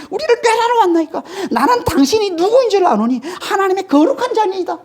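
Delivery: very high-pitched mock outcry of a demon; acted demon outcry. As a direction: cry out high all through, playing a demon before Jesus. Preacher acts demons confronting Jesus. (예수 앞 귀신의 아주 높은 외침 흉내)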